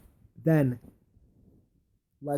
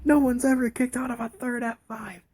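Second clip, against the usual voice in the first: much higher, put-on announcer disbelief; stunned commentator; 12 semitones higher than usual. Commentators reacting to the third F five, high mock disbelief. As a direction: squeal it like a shocked ringside caller.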